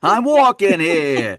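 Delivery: loud, drawn-out New York holler; hammed-up New Yorker, high and slow; New York accent. Shown as a demonstration. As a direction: stretch it into a broad New York yell. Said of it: "a little New Yorker".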